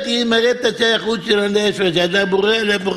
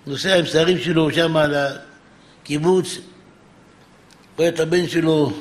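usual speaking voice

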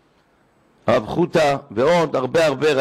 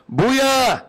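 calling out; 9 semitones higher, much slower than usual. Retold or imitated. Rabbi calls the father.

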